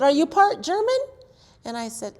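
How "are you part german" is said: put-on old neighbor voice. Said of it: "And she said".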